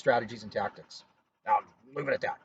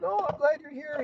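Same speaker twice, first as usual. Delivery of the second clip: high, grateful patient's voice; relieved patient; 12 semitones higher than usual. Retold or imitated. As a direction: play the relieved patient greeting the medic. Paramedic voicing a relieved patient.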